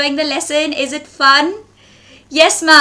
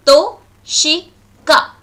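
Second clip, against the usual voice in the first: TO, SHI, KA as separate beats with clear pauses; broken into syllables; 3 dB louder, slower than usual. Shown as a demonstration. Teacher shows a class three syllables, To - shi - ka broken apart. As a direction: say To, shi, ka one syllable at a time. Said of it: "three syllables".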